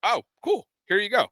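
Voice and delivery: high, offhand retold reply; mock-casual handing over; breezy acceptance. Great-grandfather's reply, acted out.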